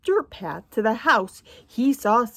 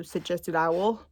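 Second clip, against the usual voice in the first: ordinary delivery, nothing marked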